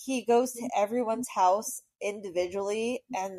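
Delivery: normal talking voice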